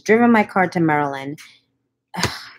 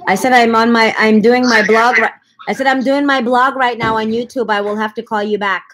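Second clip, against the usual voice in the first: to someone hard of hearing; 5 semitones higher, 4 dB louder than usual. Real, not acted. Repeating loudly to caller on phone.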